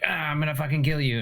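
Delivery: mock threat. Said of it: "had that moment of".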